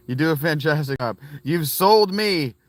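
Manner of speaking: theatrical lovestruck fan; mock swooning. Real, not acted. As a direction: melt over the voice in a slow, hammed-up way. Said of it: "Just do that voice".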